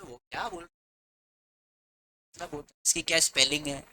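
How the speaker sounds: soft, puzzled student mumble; mock confused student. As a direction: act lost over the spelling. Students confused by English word. (उलझा हुआ छात्र, धीमी आवाज़)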